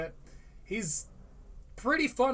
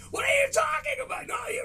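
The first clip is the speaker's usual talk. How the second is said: much higher mock yell; retold yell at phone; faster than usual. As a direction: shout back at the podcast on your phone. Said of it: "I'm yelling at my phone".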